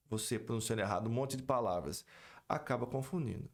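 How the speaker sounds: usual speaking voice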